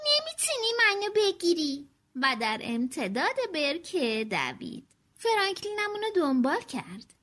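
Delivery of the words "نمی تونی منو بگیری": playful shout. Acted. Little sister teasing her brother during chase, playful shout. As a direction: call out a dare while running off. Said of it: "هریت فریاد زد".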